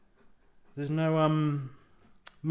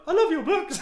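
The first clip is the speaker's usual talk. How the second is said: high giggly echo of the fan line; laughing squeak; 5 dB louder than usual. Retold or imitated. Author laughs, echoing the fan who caught her out.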